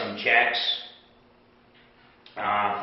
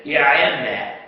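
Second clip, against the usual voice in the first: louder angry retort; a retold cop's angry answer; mock angry reply; 4 semitones higher, 8 dB louder than usual. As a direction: quote him snapping back, louder.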